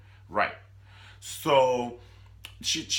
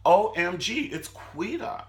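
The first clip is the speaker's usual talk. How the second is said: much higher OMG; shocked; 10 semitones higher, 6 dB louder, slower than usual. Real, not acted. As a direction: gasp it out high. Shocked exclamation.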